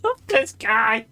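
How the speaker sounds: high, drawn-out, near-tearful laughing voice; weepy with real laughter; helpless laughter. Real, not acted. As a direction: squeeze it out while cracking up. Still cracking up at the robot.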